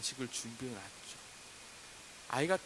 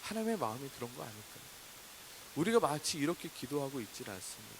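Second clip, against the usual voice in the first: ordinary talk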